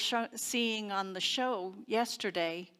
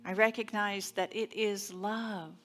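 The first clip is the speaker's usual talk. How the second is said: affirmative prayer. Acted. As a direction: lead the congregation inward, gently and unhurried. Leads a spoken affirmative prayer.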